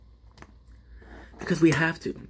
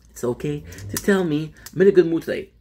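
ordinary talk

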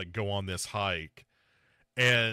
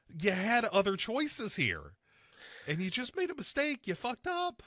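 exasperated scolding; 11 semitones higher than usual. Acted. Mock scolding of the casting choice.

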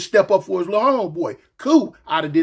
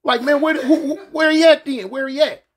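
riled-up search; 6 semitones higher than usual. Retold or imitated. Inmate hunting for the man who crossed his homeboy, riled up and higher. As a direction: fire it off like a man hunting someone down. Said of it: "now he on some trip".

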